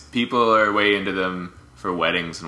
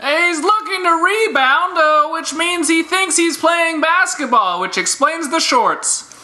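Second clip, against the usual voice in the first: high silly announcer voice; 4 dB louder, slower than usual. Acted. Mock scouting report, high voice.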